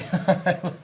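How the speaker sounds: cracking up at the joke; laughing. Real, not acted. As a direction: burst of laughter at the pun. Laughs at hardly know her.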